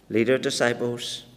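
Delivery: usual speaking voice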